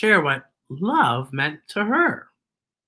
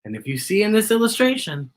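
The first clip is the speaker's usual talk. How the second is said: usual speaking voice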